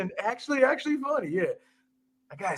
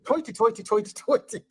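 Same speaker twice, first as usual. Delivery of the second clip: mock Irish accent; 3 semitones higher than usual. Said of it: "fighting Irish".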